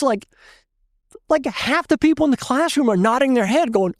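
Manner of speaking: plain reading voice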